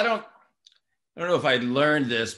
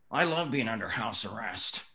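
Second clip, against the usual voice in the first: Charlie Sheen voice; 6 semitones lower, 6 dB quieter than usual. Retold or imitated. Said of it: "running lines in his voice".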